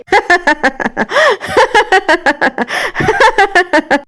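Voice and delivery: high, long manic cackle; hysterical laugh. A narrator's reading. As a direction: laugh like she has snapped. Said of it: "وقفت تضحك بهستيريا".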